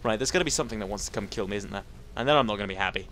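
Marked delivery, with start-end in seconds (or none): none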